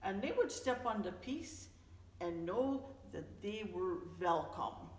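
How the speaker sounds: plain reading voice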